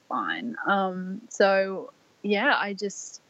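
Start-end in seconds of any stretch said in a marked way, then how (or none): 0.1-0.6 resigned. Retold or imitated. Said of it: "I was like".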